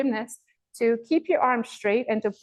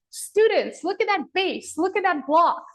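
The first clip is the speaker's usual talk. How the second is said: high, loud teacher's call across the room; excited calling out; 9 semitones higher, 3 dB louder than usual. Retold or imitated. Coach calling students' attention to gymnasts, excited shout. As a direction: point it out to students, thrilled.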